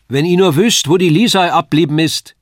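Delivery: loud, high-pitched dialect call; calling across. A narrator's reading. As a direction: shout over to your wife across the hall. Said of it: "Frau hinüberrufen".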